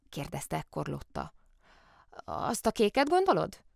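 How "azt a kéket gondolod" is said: high-pitched wondering question; amazed. A narrator's reading. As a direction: ask in innocent amazement. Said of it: "álmélkodott Kóbor Muki".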